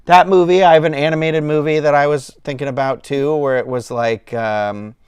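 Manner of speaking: ordinary talk, nothing marked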